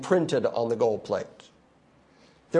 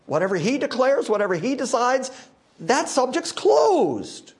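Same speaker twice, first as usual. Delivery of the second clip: full force preaching; 10 semitones higher than usual. Acted. Preacher to congregation, full force. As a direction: preach it hard, slowing on the peak.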